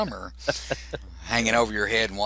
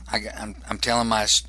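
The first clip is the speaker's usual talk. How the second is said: ordinary talk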